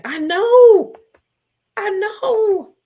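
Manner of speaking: high, loud, drawn-out wail; tearful wail. Real, not acted. Commiserating with a tearful listener.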